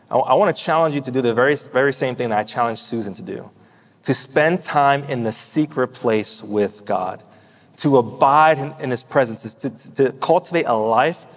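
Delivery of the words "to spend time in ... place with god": ordinary talk, nothing marked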